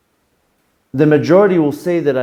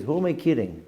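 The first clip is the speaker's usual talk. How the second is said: quiet muttered catchphrase; 6 dB quieter than usual. Shown as a demonstration. Teacher repeating his catchphrase softly to himself. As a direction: drop it down and keep it inward. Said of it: "That's the term I used to use".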